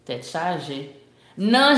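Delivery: usual speaking voice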